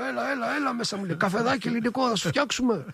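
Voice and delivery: a hint of the maestro's hearty welcome, up high; Mikis's style. Guest recalls Mikis greeting him at the door, mildly imitated. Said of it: "με το στυλ του".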